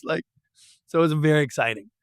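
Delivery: ordinary talk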